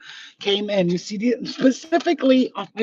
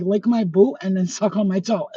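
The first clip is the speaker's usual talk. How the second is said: ordinary delivery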